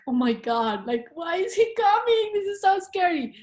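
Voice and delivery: high, alarmed inner voice; panicked at a stranger coming; mock frightened squeal; scared. Retelling fear at approaching stranger.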